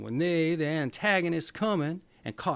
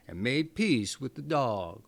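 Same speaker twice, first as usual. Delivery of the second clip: ordinary delivery, nothing marked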